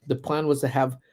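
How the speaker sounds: normal talking voice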